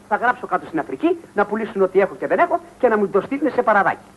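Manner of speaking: normal talking voice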